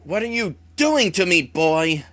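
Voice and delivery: furious pained villain. Acted. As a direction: lash out at the boy in fury. Wraith in pain and anger.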